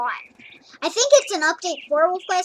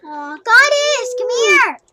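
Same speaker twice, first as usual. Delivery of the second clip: high, slow, louder coaxing call; calling the cat; 7 semitones higher, 8 dB louder, slower than usual. Real, not acted. Gamer coaxing her cat Goddess over, high slow call.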